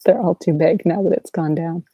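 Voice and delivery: ordinary talk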